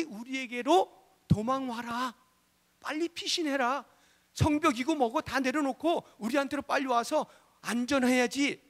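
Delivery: high-pitched, pressing alarm; acted-out plea to flee at once; urgent warning. Neighbours urgently warning of danger.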